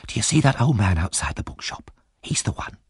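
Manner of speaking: hushed, sinking into a whisper; secretive undertone. A narrator's reading. Dodger pointing out a target to Oliver, secretive.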